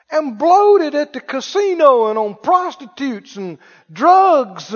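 resentful brother ranting, pitch climbing; indignant older son. Acted. Older brother ranting to himself about father, resentful and rising. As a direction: let the grievance rise into a whine.

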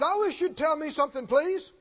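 lightly voiced troubled woman demanding an answer; troubled lady. Lady throwing down her Bible at the podium, mild plea. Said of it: "she had a stern look".

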